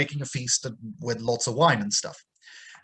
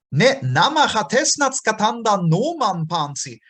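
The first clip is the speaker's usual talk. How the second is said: higher, louder recitation; recited Hittite; 8 semitones higher, 5 dB louder than usual. Acted. Teacher declaiming the Hittite text to the class. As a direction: declaim the ancient line.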